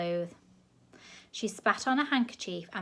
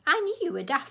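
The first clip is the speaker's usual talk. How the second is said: higher, louder scold; 9 semitones higher, 6 dB louder than usual. A narrator's reading. Grandma scolding Sid, high and loud. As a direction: tell him off.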